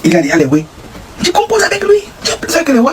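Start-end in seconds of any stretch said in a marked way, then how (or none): 1.2-2.8 high squeaky mock.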